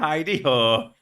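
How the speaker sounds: mock Idahoan twang. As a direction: belt out the place name in a joke Idahoan twang. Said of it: "one of those classic Idahoan twang".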